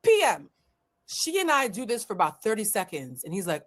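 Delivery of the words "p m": high, loud mock Russian accent; firm correction in a poor Russian accent; pitched-up imitation of a Russian accent. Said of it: "my poor rendition of a Russian accent".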